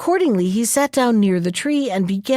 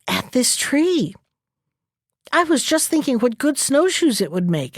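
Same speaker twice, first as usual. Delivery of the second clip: absent-minded; 4 semitones higher than usual. A narrator's reading. Fox answers the magpie while eyeing the tree, absent voice. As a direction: answer as if not thinking of what you say. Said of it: "answered the fox in an absent voice".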